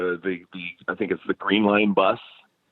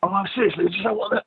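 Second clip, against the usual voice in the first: much higher put-on British accent; fake British accent; 12 semitones higher, faster than usual. Teen insisting to the chip shop clerk in a fake British accent. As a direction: keep pushing the phony British accent. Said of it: "with a fake accent".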